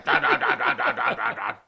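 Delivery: loud rapid gun sounds; machine gun noises. Retold or imitated. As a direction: fire off a fifty cal with your mouth. Imitating machine gun fire.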